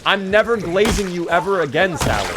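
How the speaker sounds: loud, high, fed-up vow; exasperated outburst. Real, not acted. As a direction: yell it at the character in disbelief. Streamer furious at Sally's hammer.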